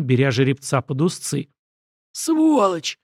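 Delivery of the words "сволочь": sharp high curse; cursing. A narrator's reading. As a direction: spit out the curse. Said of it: "выругался стрелок".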